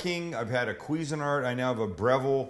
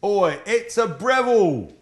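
mock Australian accent; 6 semitones higher, 7 dB louder than usual. After learning Breville is Australian.

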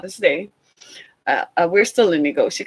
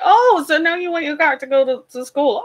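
sarcastic mock; 7 semitones higher than usual. Mocking daughter needing car.

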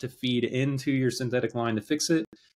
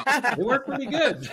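high, slow, laughing through it; 14 semitones higher, 3 dB louder, slower than usual. Real, not acted. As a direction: chuckle the words out. Joking about the rescue.

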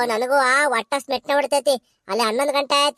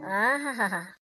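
soft relishing aha; 7 semitones lower, 8 dB quieter, much slower than usual. Acted. Wife savouring food, teasing husband.

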